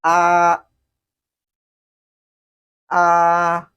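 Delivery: AH held high, level and loud; sing-steady high flat AH, no glide; first tone. Shown as a demonstration. Teacher models the first tone, high level AH. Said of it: "it goes this way".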